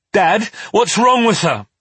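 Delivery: high, loud panicked question; alarmed child. A narrator's reading. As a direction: cry out to Dad in alarm. Frightened child asks father about mother.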